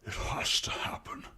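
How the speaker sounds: inhuman, unvoiced rasping whisper; rumbling, phlegmy villain. Masked killer answering the screaming captain, rumbling inhuman whisper. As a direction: rasp it softly through phlegm. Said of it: "this rumbling, phlegmy voice that sounds almost inhuman".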